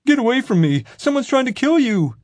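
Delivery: very high, loud panicked shriek; shrieking in fear. A narrator's reading. Nick huddled behind the counter, shrieking in fear. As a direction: scream it at them in terror. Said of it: "He shrieked in fear".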